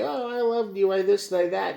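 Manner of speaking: high, sugary mock sweetness; mock lover. Ex's fake love words, high mock sweetness. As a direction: mimic empty love talk.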